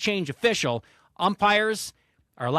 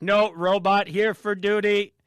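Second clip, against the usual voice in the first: robot voice; 3 semitones higher, 4 dB louder, slower than usual. Retold or imitated. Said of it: "They're like".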